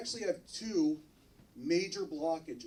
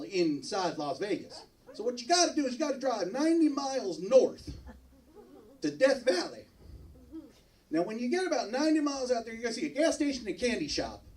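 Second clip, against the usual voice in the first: a lightly voiced, somewhat higher drawling local giving directions; rental car guy; 7 semitones higher, 4 dB louder than usual. Rental car clerk explains the road to the brothels, lightly voiced local. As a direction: play the folksy counter clerk, lightly.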